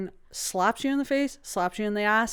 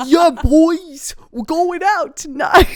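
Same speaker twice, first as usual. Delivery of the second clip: higher, louder hammed-up bro voice; bad Canadian bro accent; 7 semitones higher, 7 dB louder, slower than usual. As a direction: ham up a bad Canadian party-boy accent. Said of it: "The worst Curtis accents you've ever heard".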